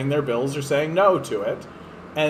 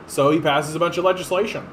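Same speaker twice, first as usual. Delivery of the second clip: usual speaking voice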